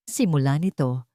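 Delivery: usual speaking voice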